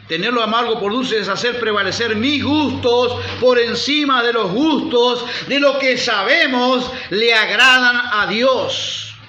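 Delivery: emphatic pulpit proclamation; forceful preaching. Real, not acted. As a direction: preach it hard, drawing out each key phrase.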